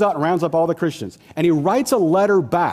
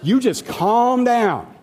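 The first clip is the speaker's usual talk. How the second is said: higher, slow settling-down plea; calming tone; 8 semitones higher, much slower than usual. Acted. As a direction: ease them down, drawn out. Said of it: "No, he says".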